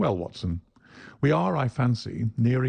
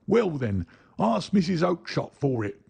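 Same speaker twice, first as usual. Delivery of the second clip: brushing off; 7 semitones higher than usual. Acted. Salesman brushing him off.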